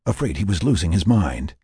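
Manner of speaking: ordinary delivery, nothing marked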